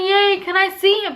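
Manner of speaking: high, loud, bubbly request; light up asking for the baby; eager joy. Retold or imitated. New mother eager to see baby.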